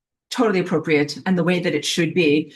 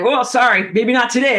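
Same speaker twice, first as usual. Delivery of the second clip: awkward apology; 5 semitones higher, 5 dB louder than usual. Retold or imitated. Owner apologizing for growling dog.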